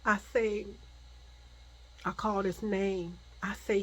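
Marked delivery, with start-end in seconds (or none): none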